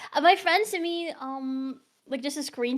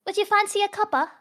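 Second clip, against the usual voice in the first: higher-pitched mock British accent; 8 semitones higher than usual. Shown as a demonstration. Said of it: "can you do a British one".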